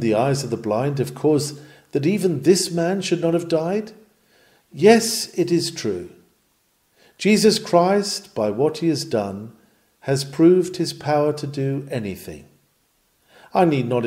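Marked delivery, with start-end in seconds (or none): none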